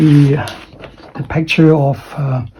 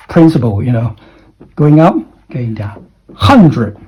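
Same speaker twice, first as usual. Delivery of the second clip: ordinary talk, nothing marked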